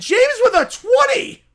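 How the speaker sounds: high, loud, drawn-out donation shout-out; excited shout. Real, not acted. Streamer thanking James for a twenty, excited shout. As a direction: shout the donor's name in real excitement.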